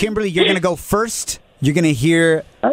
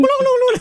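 high laugh. Acted. Gobble laugh after joke.

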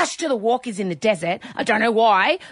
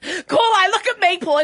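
put-on Aussie accent; 8 semitones higher, slower than usual. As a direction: scold like an Aussie mum. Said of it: "Kylo Ren in an Aussie accent".

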